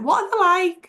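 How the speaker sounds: retold curious question, high-pitched; eager questioning. Singer quizzes her about the pubs, eager questioning. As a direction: imitate his keen follow-up, voice jumping up.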